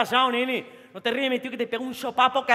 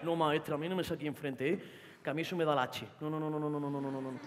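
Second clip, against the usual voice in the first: muttered refusal; 9 semitones lower, 11 dB quieter than usual. Acted.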